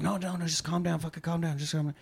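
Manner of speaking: high, hushed placating. Retold or imitated. Middle person between two arguing men, soft placating. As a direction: soothe them softly and urgently.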